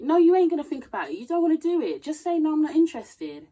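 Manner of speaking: scolding. Real, not acted. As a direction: call out the excuse, loud and quick. Scolding the excuse maker.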